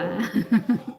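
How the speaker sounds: real short giggle; amused laugh. Real, not acted. Streamer amused by her sister's comment, giggling. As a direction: chuckle on after the word.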